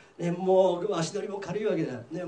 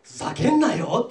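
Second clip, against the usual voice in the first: loud acted outburst; angry refusal; 2 semitones higher, 6 dB louder than usual. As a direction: play an outraged creditor. Creditor angrily refuses friend.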